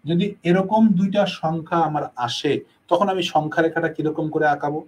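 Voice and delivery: ordinary delivery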